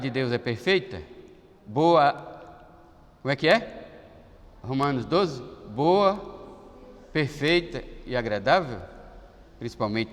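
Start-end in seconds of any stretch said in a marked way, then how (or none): none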